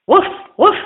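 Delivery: high, loud happy bark; eager barking. A narrator's reading. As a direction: bark it out, delighted. Dog barks eagerly.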